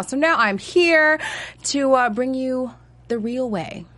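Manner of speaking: usual speaking voice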